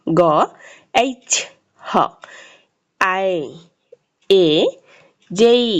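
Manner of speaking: each letter name then its sound, separated by pauses. Shown as a demonstration. Teacher models alphabet sounds for learners, careful isolated syllables. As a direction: recite isolated letters carefully, one at a time. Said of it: "সঠিক উচ্চারণ এবং সাউন্ডগুলো জেনে নিই".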